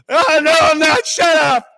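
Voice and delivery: high, loud bratty outburst; teen snapping back. Retold or imitated. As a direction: yell it back like a sulking teen. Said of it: "slam the door".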